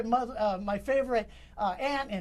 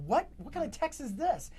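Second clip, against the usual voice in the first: higher-pitched, incredulous question; retold disbelief; 4 semitones higher than usual. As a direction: re-enact being baffled by a text. Reacting to shocking text.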